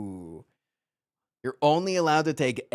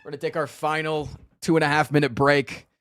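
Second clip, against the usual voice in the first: usual speaking voice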